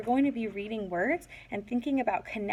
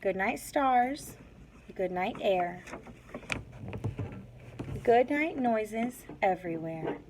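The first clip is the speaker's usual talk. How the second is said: put-on reading to children. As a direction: read it gently like a bedtime book. Teacher reads picture book aloud.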